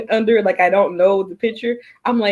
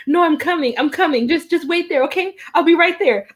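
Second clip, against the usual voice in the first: higher-pitched urgent reassurance; mock urgency; 7 semitones higher than usual. Friend fakes an emergency call to escape a date, mock urgent. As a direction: play a friend rushing over.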